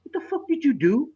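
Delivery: high-pitched imitation of an accusing wife. Ex-wife confronting dad in the store, accusing.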